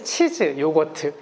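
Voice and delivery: usual speaking voice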